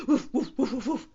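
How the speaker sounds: quick, loud woofs; dog barks. A narrator's reading. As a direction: bark in short bursts. Dog barking in story.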